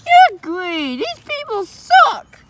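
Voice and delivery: very high, slow squeaky sneer; squeaky scorn. Acted. Creature dismissing the visitors with squeaky scorn. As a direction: squeak out your disgust.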